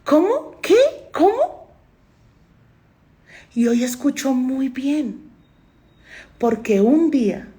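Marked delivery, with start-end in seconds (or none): 0.0-1.7 high, confused repeated what?; hard-of-hearing girl asking. Retold or imitated. Imitating her deaf younger self, high puzzled questions. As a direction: imitate someone who can't hear, pitching up on every question. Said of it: "Yo sé que es no oír".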